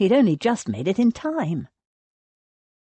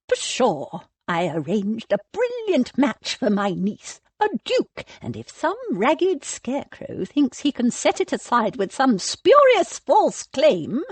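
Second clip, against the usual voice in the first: high, indignant dowager scoff; scornful old lady; 6 semitones higher than usual. A narrator's reading. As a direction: scoff at him like a haughty aunt. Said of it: "skewered the bishop with a glare".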